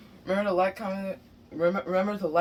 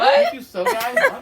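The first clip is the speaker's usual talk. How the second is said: mock high echo; 11 dB louder than usual. Mocking the flubbed outro.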